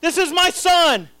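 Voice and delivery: high, fervent declaration; loud proclaiming voice. Acted. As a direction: proclaim it as the Father from heaven. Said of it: "The Father in a loud voice said".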